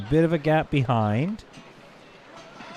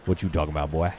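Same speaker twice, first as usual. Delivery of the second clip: deep catchphrase; 8 semitones lower, faster than usual. Retold or imitated. Streamer repeating a catchphrase in a lower put-on voice. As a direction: lower gruff what you talking about, boy.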